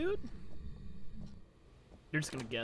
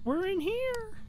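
high taunting call; much slower than usual. Real, not acted. Taunting the ghost during hunt.

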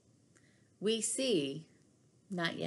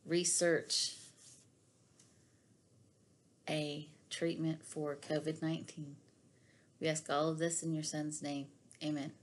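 soft, unhurried prayer voice; quiet earnest devotional prayer; closing prayer; 3 semitones lower, 5 dB quieter than usual. Real, not acted. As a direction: pray gently over the listeners.

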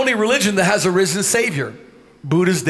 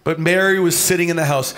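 ordinary talk, nothing marked

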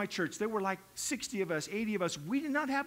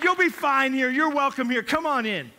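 louder, hearty welcoming call; loud welcome; 3 semitones higher, 9 dB louder than usual. Retold or imitated. Head elder welcomes the woman in slacks, hearty and loud. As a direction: welcome her in with open arms.

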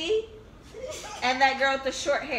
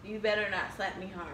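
ordinary delivery

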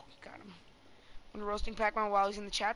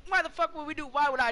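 incredulous; 6 semitones higher, 5 dB louder than usual. Real, not acted. Incredulous raised voice.

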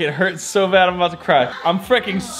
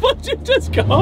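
surprised squeak. Real, not acted. Reacting to the nickname sugar balls.

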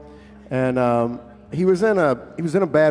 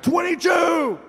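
screamed; 13 semitones higher, slower than usual. Retold or imitated. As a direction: scream the number at him again. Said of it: "he screamed in my face".

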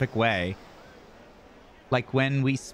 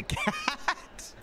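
laughing; 6 dB quieter than usual. Real, not acted. Laughing mid-joke.

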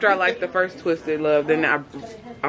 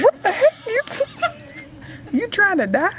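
mock shock; 12 semitones higher than usual. Friend mocking her own reaction to a book, shocked and laughing. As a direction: act scandalized at the book line, laughing. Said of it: "I'm like".

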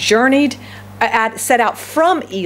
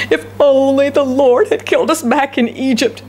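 moaning; 4 semitones higher than usual. Acted. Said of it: "they moaned".

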